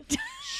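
squealing laugh. Real, not acted. Laughing fit.

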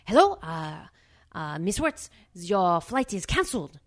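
put-on woman at a front desk with a heavy French accent; put-on, wavering French accent. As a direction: imitate a French receptionist, loosely. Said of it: "a very heavy French accent".

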